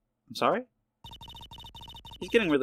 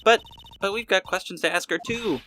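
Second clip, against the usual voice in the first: normal talking voice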